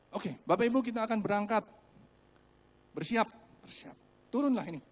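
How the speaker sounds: lightly played staff calling riders to get ready; ride attendant. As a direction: announce departure like a ride operator. Acting attendant announcing departure.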